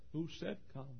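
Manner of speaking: hushed question. Acted. Voices the congregation's question.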